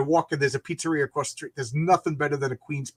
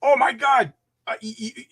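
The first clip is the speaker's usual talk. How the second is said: excited; 6 semitones higher, 4 dB louder than usual. Real, not acted. Excited about Di Fara pizza.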